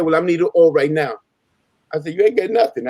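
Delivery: ordinary talk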